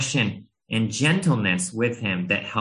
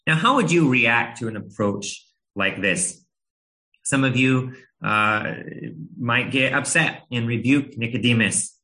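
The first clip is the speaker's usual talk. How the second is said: ordinary talk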